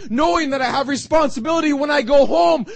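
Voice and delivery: loud, rising sermon build; impassioned preaching. Acted. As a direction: preach it with mounting fervour.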